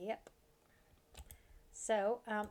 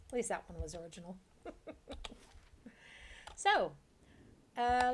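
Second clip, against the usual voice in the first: normal talking voice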